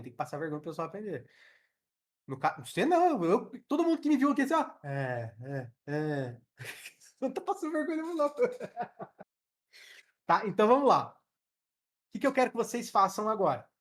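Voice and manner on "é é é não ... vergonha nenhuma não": laughing. Real, not acted. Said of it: "teacher laughs at herself".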